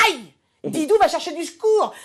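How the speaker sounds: high, loud panicked yelp; mock cry for help. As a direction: yelp in hammed-up distress. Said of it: "Et là, je dis". (cri de détresse exagéré, plus aigu et fort)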